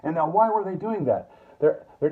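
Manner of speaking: ordinary talk, nothing marked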